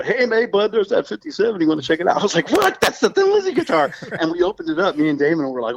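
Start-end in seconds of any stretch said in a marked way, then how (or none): 0.0-2.2 high, friendly mock offer; imitating Scott. Scott offering Damon the Les Paul, higher and friendly. 2.5-3.9 very high amazed cry; astonished. Retold or imitated. [name] seeing Scott's guitar, high and astonished.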